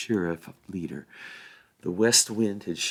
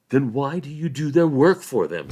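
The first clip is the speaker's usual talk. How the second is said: angry; 6 semitones higher, 6 dB louder than usual. A narrator's reading. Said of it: "said Sam angrily".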